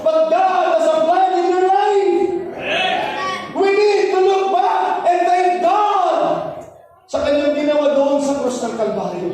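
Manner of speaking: high, loud sung-out preaching; fervent preaching. Acted. Pastor exhorts the church members, fervent preaching. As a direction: preach with rising fervour.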